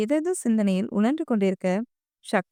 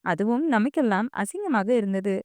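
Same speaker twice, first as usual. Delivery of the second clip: usual speaking voice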